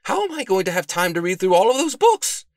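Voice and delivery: high, loud exasperated wail; loud dismay. A narrator's reading. Detective faced with a whole shelf of books, loudly dismayed. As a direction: cry out overwhelmed, pitch held high. Detective overwhelmed, gets shushed.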